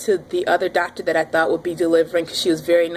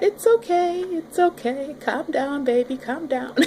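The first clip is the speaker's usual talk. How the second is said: light soothing, higher and softening; gently calm a pet cat, a bit mock; mock-soothing "calm down, baby"; 7 semitones higher, slower than usual. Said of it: "Like you would a little cat".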